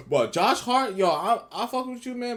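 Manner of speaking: excited shout-out. Real, not acted. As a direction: hype the player up loudly. Hyped shout-out to Josh Hart.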